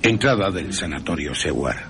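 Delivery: plain reading voice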